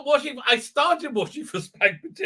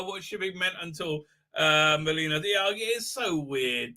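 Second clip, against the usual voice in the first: ordinary talk, nothing marked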